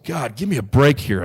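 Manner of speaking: fed-up prayer said aloud; pleading out loud. Retold or imitated. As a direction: beg for a break, aloud and worn out. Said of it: "I said it out loud".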